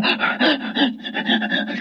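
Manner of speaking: put-on shuddering, stuttered gasps of a child; imitated sob-holding gasps. Little girl trying to hold it back, imitated gasps. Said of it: "You ever see a little child do that?".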